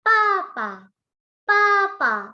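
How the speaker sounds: "Papa" called out high and loud with a falling end; tone drill. Shown as a demonstration. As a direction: drill "Papa" with a strong fall.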